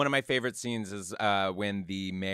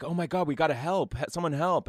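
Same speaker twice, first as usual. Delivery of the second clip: mock alarmed foreman; 6 semitones higher than usual. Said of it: "Slim Pickens walks over and is like".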